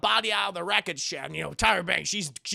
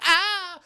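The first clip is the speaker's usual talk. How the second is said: mock scream; 10 semitones higher, 7 dB louder than usual. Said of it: "she's like".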